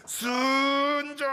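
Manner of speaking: strained, drawn-out grunt of effort; forced effort. Shown as a demonstration. (억지로 용쓰는 외침)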